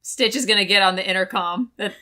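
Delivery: ordinary talk, nothing marked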